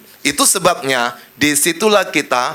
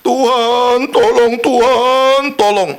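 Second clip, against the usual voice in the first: higher, louder, slow pleading cry; preacher mimics a crying churchgoer's higher, louder plea; mock tearful cry for help; 5 semitones higher, 4 dB louder, slower than usual. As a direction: wail to God like someone desperate. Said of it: "nangis dia teriak".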